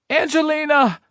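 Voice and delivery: high, far-carrying scream of a name. A narrator's reading. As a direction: shriek her daughter's name across the lot. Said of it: "a woman screams".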